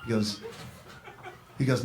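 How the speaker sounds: ordinary delivery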